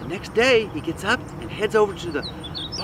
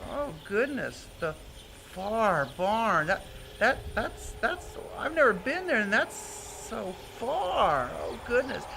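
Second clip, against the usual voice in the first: soft, halting mouse thinking aloud; hushed, daunted little mouse; worried Mister Mouse; 7 dB quieter, slower than usual. Acted. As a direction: fret quietly to yourself, with stretched words.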